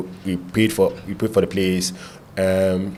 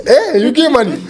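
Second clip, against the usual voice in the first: amused eager reply; 9 semitones higher, 3 dB louder than usual. Retold or imitated. His own retold reply.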